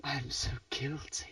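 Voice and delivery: whispered mock confession; soft, hushed pretend remorse; mock guilty whisper. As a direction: whisper it in playful shame.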